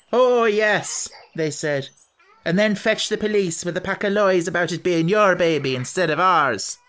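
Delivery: mock the boy's trick.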